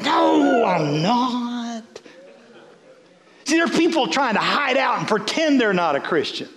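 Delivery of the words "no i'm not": imitating an indignant denial; mock protest. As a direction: protest it with a long, hammed-up no. Acted denial of being Pentecostal.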